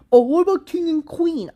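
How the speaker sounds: high, mocking whiny voice; mocking objector voice. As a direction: imitate an annoying objector, high and nasal-free mock.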